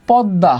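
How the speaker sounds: পদ্মা said as pod-da, m not sounded; doubled consonant pronunciation. Shown as a demonstration. Teacher shows the second mofola rule, পদ্মা as pod-da. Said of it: "দ্বিত্ব হয়".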